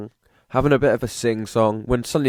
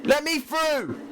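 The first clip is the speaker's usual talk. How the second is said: very high, loud, disbelieving yelling; frantic outrage; 13 semitones higher, 3 dB louder than usual. Real, not acted. Gamer stuck before the finish line, frantic high yelling. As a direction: scream at the game that won't let you through.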